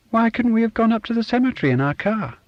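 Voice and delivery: put-on a child whining at her dad, pitched high; small girl voice. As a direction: voice a fussy little girl. Child Sonia whines to her father.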